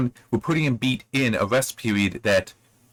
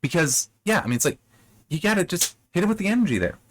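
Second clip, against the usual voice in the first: ordinary delivery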